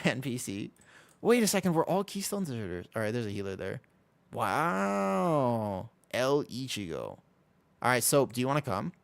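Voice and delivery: ordinary delivery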